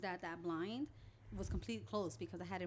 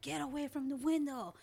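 raised, higher scolding yell; retold yell; 7 semitones higher, 5 dB louder than usual. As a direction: yell it as if warning a child off. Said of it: "we always will yell at him".

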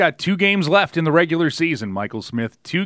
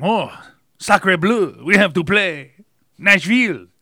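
higher, louder cartoon French accent building; hammed-up French accent, mocking Montreal fans; bad cartoon French accent; 6 semitones higher, 6 dB louder than usual. Mocking Montreal fans about their schedule, hammed-up French accent. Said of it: "Bad cartoon accent".

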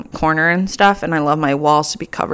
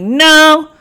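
loud, high, sassy brush-off; a hint of diva dismissal, slowing on see yourself out; 4 semitones higher, 4 dB louder, slower than usual. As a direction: send him packing with attitude. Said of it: "I'm gonna be like".

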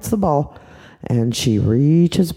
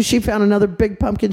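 ordinary delivery, nothing marked

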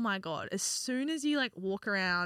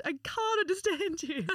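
higher, helpless mock plea; baffled apology; 8 semitones higher than usual. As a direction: act out being hopelessly lost for words. Acting out baffled reply to Scottish man.

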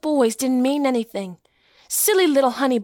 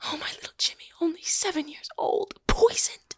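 hushed whisper cracking into a sob; broken whisper; 6 dB quieter than usual. Acted. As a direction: grieve for the poisoned child under your breath. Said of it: "she looks old and worn and gray".

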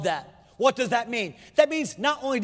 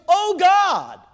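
loud, high cry to God; anguished shout from a burdened heart; 7 semitones higher, 7 dB louder than usual. Acted. Preacher voicing Asaph crying out to God in distress, loud cry. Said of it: "he cries out with his voice".

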